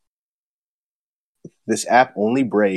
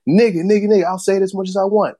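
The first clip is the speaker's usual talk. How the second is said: higher, faster mock defiance; imitated freak-out; 10 semitones higher, faster than usual. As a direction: fire it back like a defiant hothead.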